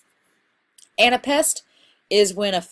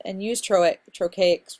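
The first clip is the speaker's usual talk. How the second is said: ordinary delivery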